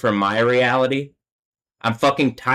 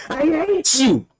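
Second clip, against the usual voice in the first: "I hate you" much higher, hate held and falling; furious cry; slower than usual. Real, not acted. Telling his tormentors he hates them, much higher in rage. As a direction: throw it out high in real rage. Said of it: "fuck, I'm angry".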